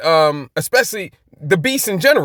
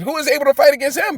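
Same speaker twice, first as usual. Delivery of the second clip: higher-pitched, quicker exclamation; exclaimed rhetorical question; 8 semitones higher, faster than usual. Acted. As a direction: exclaim it as a challenge nobody can answer. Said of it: "They exclaimed".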